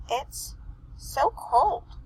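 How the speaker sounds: squeaky, blocked-nose voice; stuffy nose voice. Acted. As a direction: talk as if your nose is stuffed. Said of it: "more muffled and squeaky like a stuffy nose".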